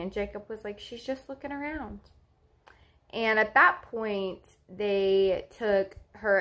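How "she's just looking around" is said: soft, higher imitation of reassuring partner; retold reassurance. Partner reassuring worried mother about baby, softly. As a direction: voice him gently, hushed and lifted.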